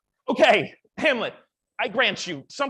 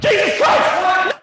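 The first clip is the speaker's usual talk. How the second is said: loud, high, drawn-out cry of shock; startled yell; 9 semitones higher, 9 dB louder, slower than usual. Acted. Man alone getting a sudden shock, startled yell.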